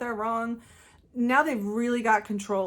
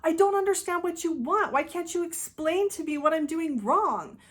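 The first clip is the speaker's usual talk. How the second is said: much higher, hurt protest; defensive reaction; 8 semitones higher than usual. Retold or imitated. Criticized by a micromanager, answering with a hurt, high protest. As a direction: protest that you're trying, voice climbing. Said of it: "natural reactionary response is to defend yourself".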